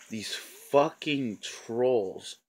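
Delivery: slow, drawn-out disgust; angry emphasis. Real, not acted. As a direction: stretch each word in real exasperation. Angry rant about trolls.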